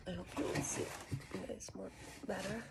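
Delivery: soft unvoiced whisper. Real, not acted. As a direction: whisper close to the mic. Quiet unboxing whisper.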